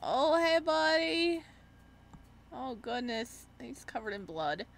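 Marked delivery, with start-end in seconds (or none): none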